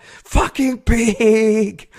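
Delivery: long, drawn-out high holler; retold yell. Cyclist yells back from further away, drawn-out shout. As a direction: stretch it into a slow, loud shout. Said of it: "I yelled out".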